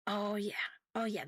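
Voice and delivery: ordinary talk, nothing marked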